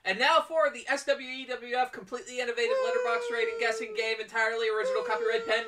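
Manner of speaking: game show host announcer. Acted. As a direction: hype up the segment like a TV host. Announcing the podcast game segment.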